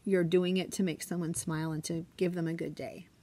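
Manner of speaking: usual speaking voice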